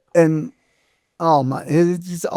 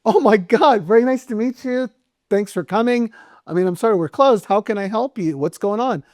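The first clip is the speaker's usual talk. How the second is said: pitched way up, rushed and flustered; suddenly starstruck, gushing at him; flustered excitement; 6 semitones higher than usual. Retold or imitated. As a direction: barman realizing who he is, high starstruck gush. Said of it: "I go".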